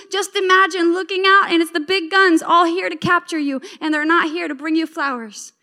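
usual speaking voice

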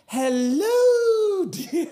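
put-on very high, loud, sung-out old-lady greeting; comic falsetto granny voice breaking into laughter; Missus Doubtfire. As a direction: trill a long, hammed-up falsetto hello. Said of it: "I pull a Missus Doubtfire out".

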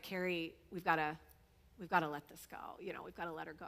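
gentle, defeated murmur to his wife; soft, sad plea. Retold or imitated. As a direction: say it low and hushed, as if breaking bad news. Husband gently says it's time.

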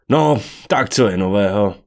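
loud, abrupt small talk; blurted out. A narrator's reading. Daniel breaks an embarrassing moment, blurting. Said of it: "vyhrkl Daniel, aby přerušil trapnou chvíli".

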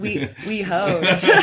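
"we hoes" drawn out slowly through a laugh; laughing pun. Pretend. Host laughing as she turns "WeHo" into "we hoes". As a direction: stretch out "we hoes" as a giggling joke.

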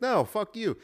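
pretending to be the doctor telling off a caller; mock blunt brush-off. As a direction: throw it out like a smug jerk. Imagining own blunt reply.

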